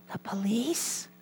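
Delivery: put-on high, soft child whisper; whispering little boy. Little boy answers a telemarketer's call, whispering. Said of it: "still whispering, the little voice said".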